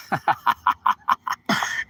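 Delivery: long staccato re-enacted laugh; mocking laugh. Man brushing off a suspected cop, mocking laughter. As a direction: laugh right at him in a run of ha-has. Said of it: "And I laughed at him".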